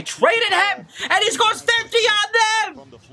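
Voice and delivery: high, drawn-out disbelief; excited squeal of disbelief; incredulous outburst. Real, not acted. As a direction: pitch it way up, stretching each word in amazement. Amazed fan recalling the trade.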